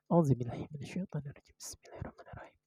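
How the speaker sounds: quick, hushed prayer under the breath; whispered prayer. Real, not acted. Tarot reader praying before the card reading, whispered. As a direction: whisper it fast, fading almost to nothing.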